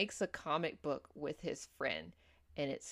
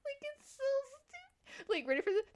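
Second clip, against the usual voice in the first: real helpless laughing; much faster than usual. Real, not acted. Telling co-host how dumb the film is, helplessly laughing. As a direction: barely get the words out for laughing.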